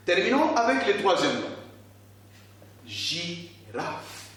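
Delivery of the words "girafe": "girafe" split as gi... rafe, softly; "girafe" as zhee, pause, raf; syllable by syllable. Shown as a demonstration. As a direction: sound out "girafe" slowly in separate beats. Teacher splits word into syllables.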